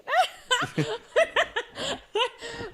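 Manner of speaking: high, loud burst of laughter; long, uncontrollable laughing fit; helpless laughter. Real, not acted. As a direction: crack up at your own story. Cracks up at her own story.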